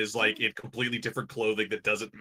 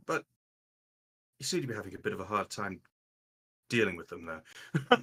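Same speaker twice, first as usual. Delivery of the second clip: softer, lower in-character voice; Raylan, gently concerned; 5 semitones lower, 7 dB quieter than usual. Acted. Raylan notes Evangeline is having a hard time, soft and gently concerned. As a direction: ease off into a quiet, careful observation.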